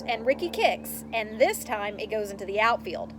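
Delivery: ordinary delivery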